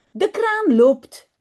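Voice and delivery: storytelling emphasis. A narrator's reading. Storytelling the running tap.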